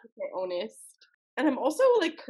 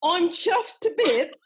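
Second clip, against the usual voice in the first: put-on high, slow, loud try at a British accent; overdone British, drawn out and pitched high; attempted British accent; 8 semitones higher, 5 dB louder, slower than usual. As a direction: play at a broad British accent. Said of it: "trying to do Cockney".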